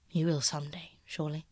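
lightly voiced painter, half-hushed and earnest. Basil confessing to Dorian, hushed and earnest. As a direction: let it drop low and confiding.

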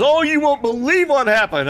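high, loud breathless excitement; bursting to tell the news; excited. Retold or imitated. Mother telling Santa the news, high excited voice.

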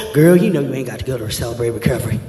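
coaxing party friend, low and quick; tempting friend. Retold or imitated. Preacher voicing a friend luring someone from recovery, sly coaxing. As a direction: lure them away like a smooth homeboy.

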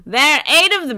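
high, loud mock panic; hammed-up alarmed witness; frantic witness. Frantic witness quote acted out.

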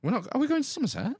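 fast, high, startled question; surprised. Real, not acted. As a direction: blurt it out in disbelief. Surprised by trip news.

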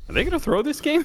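worried surprise. Retold or imitated. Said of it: "I was like".

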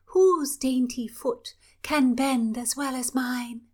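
high, slow, preening princess; lightly voiced vain princess. Princess showing off.

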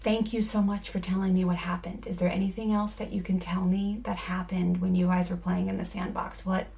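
soft, gentle reassurance; a mother checking on a child with sand in his eyes, soft and gentle; calm caring mom. Acted. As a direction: quietly soothe the upset kids. Calm listening mom role play.

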